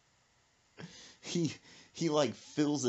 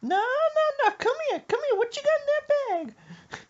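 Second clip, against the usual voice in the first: high, loud demanding squawk; nosy mama voice; 6 dB louder than usual. Retold or imitated. Mother questioning son about his bag, high squawky demand.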